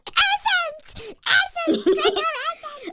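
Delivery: very high, loud screech; Skeksis voice. Retold or imitated. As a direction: howl it out like a hungry ghoul.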